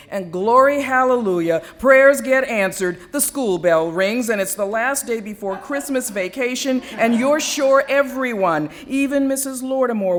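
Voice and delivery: loud jubilant hallelujah; joyous outburst. Acted. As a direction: shout the glory of being saved by the bell. Exultant relief as bell rings.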